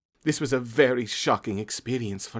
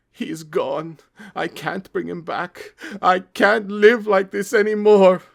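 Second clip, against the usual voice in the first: higher, slow despairing mother, louder at the end; grieving mom; 9 semitones higher, 8 dB louder, slower than usual. Acted. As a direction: grief building to a cry of despair. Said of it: "Mom Asparagus crying".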